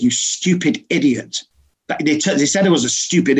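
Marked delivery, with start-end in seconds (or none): none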